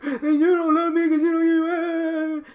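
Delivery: loud, high mock wail; crying infant. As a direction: bawl like a baby, dragging out the last words. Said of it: "you're being an infant with them".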